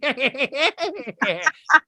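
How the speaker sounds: high burst of laughter. Real, not acted. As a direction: laugh at the bit. After gushing impression.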